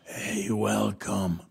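put-on low, slow raspy old man's voice; raspy old man ghost. Ghost of an old man greeting him in the basement, low slow rasp. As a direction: croak it like a seventy-year-old ghost. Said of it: "raspy la voz como de un viejito de unos setenta".

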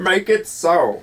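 terrible, drawn-out starship captain impersonation; badly faked captain, stretching the order out; Jean-Luc Picard. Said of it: "terrible Jean-Luc Picard impersonation".

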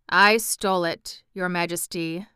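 ordinary delivery, nothing marked

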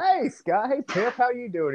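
high-pitched friendly phone greeting; Michael Jordan. Acted. Imitating a star answering his teammate's call, high and friendly. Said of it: "And Mike's like".

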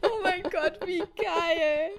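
high, loud, laughing delight. Real, not acted. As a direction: squeal with laughter. Reacting to funny anecdote.

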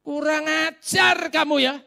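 high, loud, slow raging yell; furious shout. Retold or imitated. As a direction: bellow at the preacher in outrage. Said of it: "Orangnya ngamuk". (teriakan marah, keras dan tinggi)

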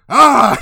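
hammed-up stabbing shriek; mock battle cry. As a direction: let out a short attack yell. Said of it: "And Becca said".

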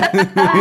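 loud short laugh; burst of laughter. Real, not acted. Laughs at joke. (risada alta e curta)

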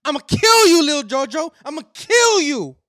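high, loud threatening yell; angry threat. Retold or imitated. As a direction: scream the threat. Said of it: "[name] told him".

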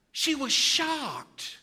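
high, very slow, stretched-out shocked line; stunned awe. Shown as a demonstration. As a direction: draw it out like it blew your mind. Preacher dramatizes Mary's shock.

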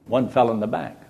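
ordinary talk, nothing marked

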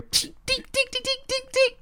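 tiny high-pitched tink sounds; ping like little pellets hitting a tower; imitated pinging pellets. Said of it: "then we hear".

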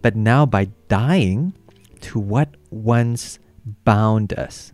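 very slow, phrase by phrase; deliberate, drawn-out scripture reading; focused close reading. Real, not acted. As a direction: pause after each phrase and weigh it. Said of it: "Let me, let me focus on this".

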